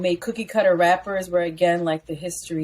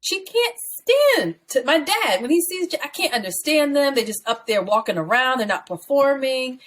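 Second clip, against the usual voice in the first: put-on high, scandalized old-school parent; disapproving parent voice; 7 semitones higher than usual. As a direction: channel a shocked mom from back in the day. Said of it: "my mom".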